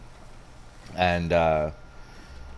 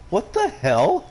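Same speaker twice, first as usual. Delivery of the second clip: wife's surprise; 12 semitones higher, slower than usual. Retold or imitated. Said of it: "Even my wife was like".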